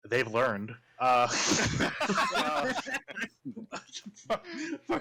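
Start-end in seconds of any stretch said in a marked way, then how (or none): 1.3-5.0 real laughter, much higher; cursing through helpless laughter; giggling fit breaking the narration; laughing. Real, not acted. GM cracking up mid-scene.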